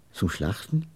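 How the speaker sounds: low, soft questioning echo; hushed, dismayed question. A narrator's reading. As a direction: repeat it quietly, taken aback. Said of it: "Wir waren beide betroffen".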